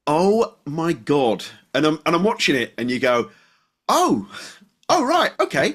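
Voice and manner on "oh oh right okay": high-pitched "oh" of dawning realisation; startled realisation. Retold or imitated. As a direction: act out being taken aback, pitched well up. Acting out a viewer's startled realisation.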